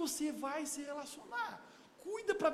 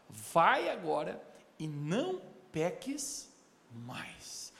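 slow solemn words; 7 semitones lower, much slower than usual. Acted.

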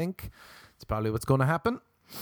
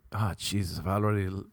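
normal talking voice

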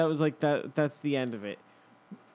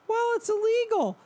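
much higher put-on voice; squeaky lady; 4 dB louder than usual. The lady ordering the driver, squeaky. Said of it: "her voice was very like this".

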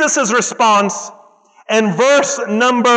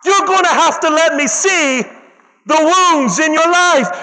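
rising sermon climax, hammering each phrase; preaching at full force; 5 semitones higher than usual. Acted. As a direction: preach it like a revelation.

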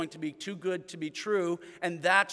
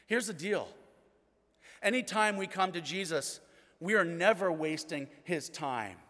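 ordinary delivery

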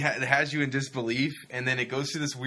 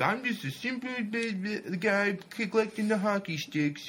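simpleton voice; 8 semitones higher than usual. Retold or imitated. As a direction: ham up the simple-minded hillbilly. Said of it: "have Michael Parks do this sort of, like, simpleton".

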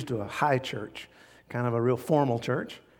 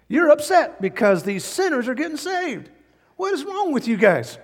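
much higher, louder rebuke; rebuking; 11 semitones higher, 4 dB louder than usual. Acted. Preacher scolds grumbling scribes, much higher and louder.

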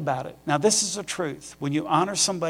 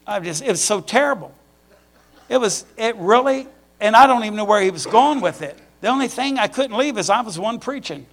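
much louder and higher, building with amusement; bursting with laughter at his own story; amused outburst; 6 semitones higher, 8 dB louder than usual. Real, not acted. Telling the church about a boring sermon, laughing loudly.

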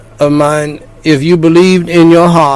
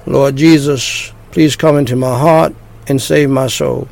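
short phrases with pauses. Shown as a demonstration. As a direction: pause after each phrase for repeating. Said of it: "phrase by phrase".

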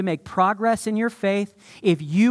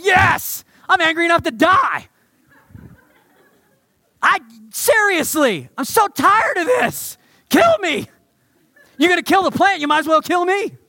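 loud, much higher angry rant; furious outburst; 10 semitones higher, 6 dB louder than usual. Retold or imitated. Jonah shouting at God that he'd rather die, furious. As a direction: yell like a sulking prophet who's had enough. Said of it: "And Jonah says".

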